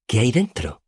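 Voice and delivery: curious Auri. A narrator's reading. Auri sniffing the wine bottle, curious high voice. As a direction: ask with childlike curiosity.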